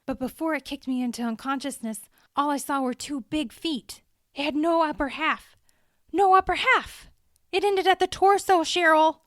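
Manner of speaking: high, loud, rising panic; frantic. A narrator's reading. Andy frantically telling Cheryl what attacked him, high and loud. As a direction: blurt it out, building to near shouting.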